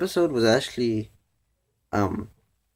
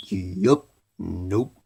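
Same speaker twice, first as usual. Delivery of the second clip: quoting Big Mac; 5 semitones lower, 4 dB quieter than usual. Retold or imitated. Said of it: "that's quoting Big Mac".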